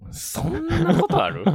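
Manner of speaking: loud, high, drawn-out disbelief; incredulous retort. Real, not acted. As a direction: blurt it out in slow, high-pitched disbelief. Comic retort to absurd impression. (高い声で呆れたツッコミ)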